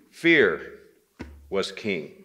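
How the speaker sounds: plain reading voice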